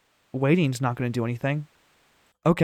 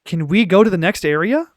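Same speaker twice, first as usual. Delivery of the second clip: louder, higher, rising question; insistent asking; 5 semitones higher, 6 dB louder than usual. Real, not acted. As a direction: ask it again louder at an unresponsive game. Repeats it as game stays frozen.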